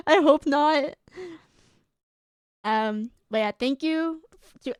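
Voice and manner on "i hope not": I hope not through a teary laugh; laugh-crying. Real, not acted.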